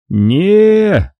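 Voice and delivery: slow, blunt giant's refusal; a lumbering doorman says no; thick monster voice; dim brute. Acted.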